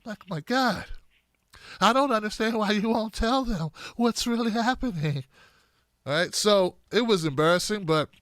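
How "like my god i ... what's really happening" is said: slow, soft, pleading mock of Elizabeth Warren; softer, slowed-down Warren imitation. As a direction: whimper it out slowly like a scolded senator. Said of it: "like she's about to burst into tears".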